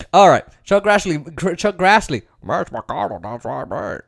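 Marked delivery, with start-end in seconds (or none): 2.4-4.0 low, soft, mumbled character voice; put-on impression. Host revives an old senator impression, low mumble. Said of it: "I haven't done that impression in a while".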